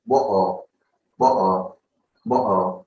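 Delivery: "What? Uh" as one word, water without its T; glottal T. Shown as a demonstration. Teacher answers own question, "What? Uh" with glottal stop. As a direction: catch the throat between "What" and "Uh", no T. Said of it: "in case I want to use glottal T".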